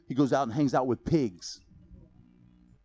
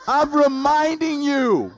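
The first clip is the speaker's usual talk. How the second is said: loud, slow, laughing claim; laughing declaration; 12 semitones higher, 7 dB louder, slower than usual. Real, not acted. Preacher laughs, claiming to be the monkey for his church. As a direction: announce it through laughter. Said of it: "I'm the monkey".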